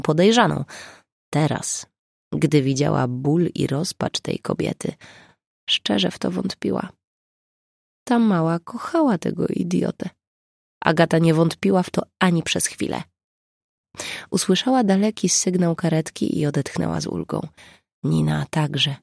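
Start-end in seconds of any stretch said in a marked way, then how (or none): none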